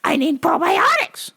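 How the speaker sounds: high impatient mock old lady; annoyed old caller. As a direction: huff it at him, fed up.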